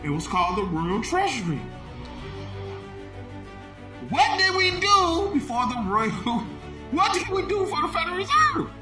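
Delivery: ordinary delivery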